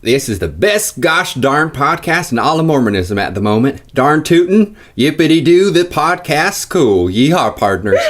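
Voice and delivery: hammed-up cowboy reading in a Southern accent. Host reads a listener review aloud in a Southern accent. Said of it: "here's my best swing at that".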